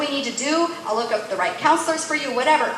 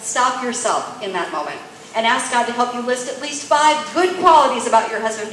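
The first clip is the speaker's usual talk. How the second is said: ordinary talk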